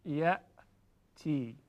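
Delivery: یأتی said very slowly with a clear catch in the throat; glottal stop demo. Shown as a demonstration. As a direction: cut the air at the hamza in یأتی. (یأتی با همزه واضح و کشیده)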